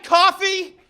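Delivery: high screaming accusation; high, loud enraged outburst; furious yell. Retold or imitated. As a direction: scream at the guy in the next car. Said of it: "he goes".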